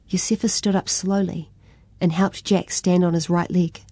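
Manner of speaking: plain reading voice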